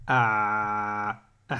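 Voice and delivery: deep strained throat "Ah"; throat ain. Shown as a demonstration. Teacher showing the blocked throat sound. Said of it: "la différence de son".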